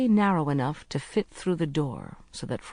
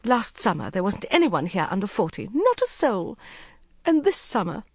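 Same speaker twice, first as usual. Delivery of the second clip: high, rising complaint; a hint of whining distress; Court, distressed young woman; 6 semitones higher than usual. A narrator's reading. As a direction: lament the change. Narrator voices Court.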